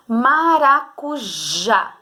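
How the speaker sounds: "Maracujá" drawn out very slowly, syllable by syllable; slow sounding out. Shown as a demonstration. Teacher sounding out "Maracujá" for pupils, very slow. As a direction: stretch "Maracujá" to reveal the strong last syllable. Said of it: "falar com calma pra você descobrir".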